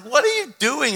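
high-pitched incredulous query; baffled question. Retold or imitated. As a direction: re-enact being baffled by a friend. Baffled pastor questions busy friend.

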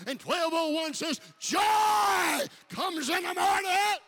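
loud, building shouted sermon, slowing at the end; preaching at full force. Acted. Preacher promising the church, rising to a shout. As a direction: build the crowd up to a roar.

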